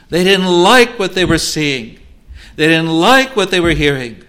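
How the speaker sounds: rising, fuller pulpit voice pleading over lost chances; impassioned sermon appeal; fervent preaching. Acted. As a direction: preach it out with longing. Preacher presses the point.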